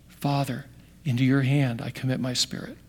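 very soft, low last words; quiet, reverent final prayer; gentle dying surrender. Acted. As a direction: let it sink to a hush, yielding in trust. Acting Jesus's last words.

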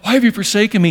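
acted cry from the cross, restrained. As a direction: lift the pitch as if calling out to God. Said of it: "as he cries out".

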